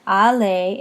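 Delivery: French "allez" ending in an open vowel; silent final Z. Shown as a demonstration. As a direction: say "allez" as ah-LAY, no Z heard. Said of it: "but we don't hear that".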